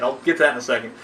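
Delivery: ordinary delivery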